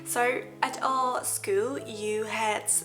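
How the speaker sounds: normal talking voice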